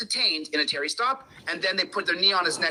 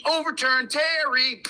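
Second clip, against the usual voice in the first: telling them off; 4 semitones higher, much slower than usual. Retold or imitated. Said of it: "I tell them".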